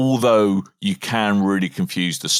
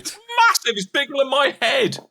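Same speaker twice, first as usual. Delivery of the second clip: excited squeal; 14 semitones higher than usual. Real, not acted. Excited squeal about new device.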